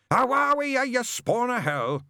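high, loud, drawn-out roar; outraged yell. Acted. Witchfinder denounces the angel on the phone, yelling. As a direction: bellow an accusation, stretching the words.

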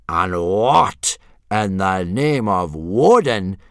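loud, slow, incredulous; appalled demand. A narrator's reading. Instructor spotting a puny dragon, demanding loudly. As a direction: draw out each word in outrage. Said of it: "demanded Gobber, blanching a little".

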